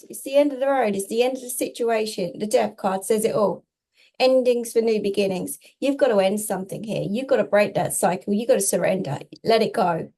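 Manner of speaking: ordinary delivery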